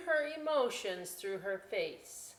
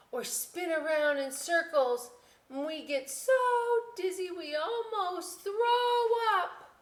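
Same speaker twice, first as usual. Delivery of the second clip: much higher, louder, sing-song; eager girl; 12 semitones higher, 6 dB louder, slower than usual. Acted. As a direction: get carried away with it.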